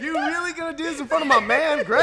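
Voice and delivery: high laughing protest; hammed-up indignant, laughing; mock outrage.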